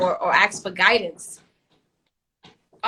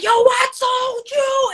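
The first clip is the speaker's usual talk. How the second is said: high, loud, slow exasperated shout; imitated yell; 13 semitones higher, 8 dB louder, slower than usual. Husband yelling at the disobedient daughter, loud and slow. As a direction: bellow it like a fed-up dad, much higher. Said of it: "He'd be like".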